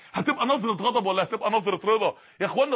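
ordinary delivery

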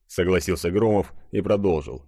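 ordinary delivery

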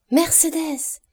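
high-pitched happy call from outside; joyful call. A narrator's reading. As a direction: call out the name gladly, pitched well up. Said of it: "cria une voix joyeuse au dehors de la maison". (appel joyeux, d'une voix haute)

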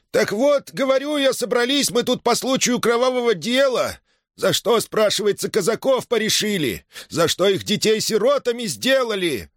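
high, agitated public outcry; voice breaking with agitation. A narrator's reading. Arkhip addresses the gathering about killed Cossacks, highly agitated. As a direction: plead indignantly, pitched high. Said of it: "Голос Архипа рвался от волнения".

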